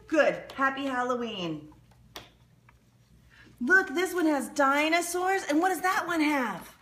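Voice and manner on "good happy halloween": ordinary talk, nothing marked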